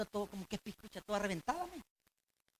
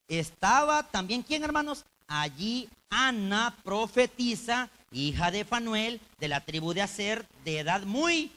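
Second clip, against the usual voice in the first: loud, slow, ringing preacher's delivery; booming slow sermon read; preaching at full force; 4 semitones higher, 10 dB louder, slower than usual. Acted. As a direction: proclaim the scripture to the congregation.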